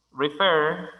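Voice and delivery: "Refer" with the weight on FER; stress on second syllable. Shown as a demonstration. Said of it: "tiene énfasis de sonido en la segunda".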